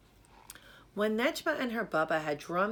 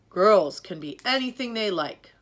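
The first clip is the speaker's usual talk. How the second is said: louder, proud and certain; firm encouragement; 3 semitones higher, 9 dB louder than usual. Acted. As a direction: insist to your daughter she can. Baba encourages Nejma.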